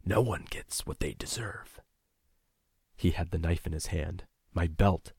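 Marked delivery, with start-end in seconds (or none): 0.0-1.8 slow, cold, unvoiced whisper; menacing whisper. A narrator's reading. Attacker reveals his deception to the narrator in a cold slow whisper. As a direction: hiss it softly, the act dropped. Villain drops the act, menacing.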